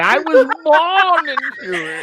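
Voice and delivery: rough villain growl; hammed-up Bane. Bane quote parody.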